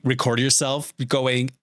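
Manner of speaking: normal talking voice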